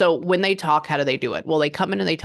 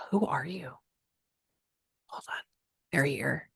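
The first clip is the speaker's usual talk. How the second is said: hushed question; 8 dB quieter than usual. Real, not acted. Psychic addressing an arriving spirit.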